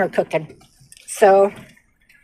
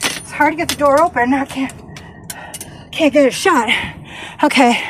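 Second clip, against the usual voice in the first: out of breath; 6 semitones higher than usual. Real, not acted. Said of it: "Sorry, I'm out of breath".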